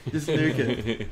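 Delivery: normal talking voice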